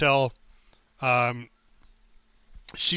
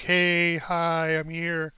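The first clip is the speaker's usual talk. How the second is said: sheepish recruit; much slower than usual. Retold or imitated. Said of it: "they're very sheepish".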